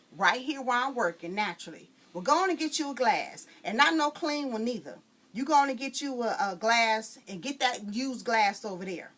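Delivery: loud, high scolding Mama; scolding mother. Acted. Mama scolds the girl about the glass.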